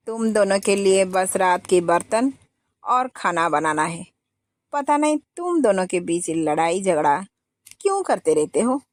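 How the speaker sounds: ordinary delivery, nothing marked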